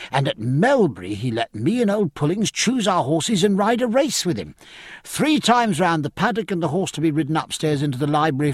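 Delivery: put-on eager, gushing young officer, often pitched high; enthusiastic young midshipman. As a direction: rattle on admiringly about your beloved captain. Plays enthusiastic young Babington.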